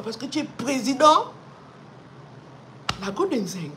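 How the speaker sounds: ordinary delivery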